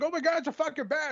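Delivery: high, startled cry; shocked discovery. Pretend. Guest finds a bat in the laundry, startled high cry. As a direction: jump as if a bat appears.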